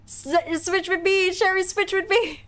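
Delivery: high-pitched plea to swap; playful panicked plea. Pretend. Pleads with Sherry to switch places.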